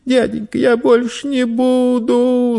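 put-on very high, drawn-out boy's whine; thin, stretched-out promise; tearful pleading. A narrator's reading. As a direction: plead like a child close to tears. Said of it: "со слезами на глазах и с дрожащими губами в полголоса".

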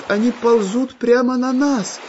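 high, scared cry; frightened exclamation. A narrator's reading. A boy sees plants crawling toward them, frightened cry. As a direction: shout it in fright. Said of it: "испуганно воскликнул мальчик".

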